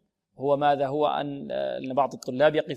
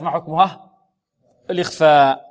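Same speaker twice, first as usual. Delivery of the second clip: ordinary talk, nothing marked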